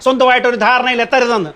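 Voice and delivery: indignant, heated telling-off; heated scolding. Acted. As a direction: scold your wife, voice climbing. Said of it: "ഇതെന്തിനാ ഇങ്ങനെ കിടന്ന് ചൂടാവണത്?".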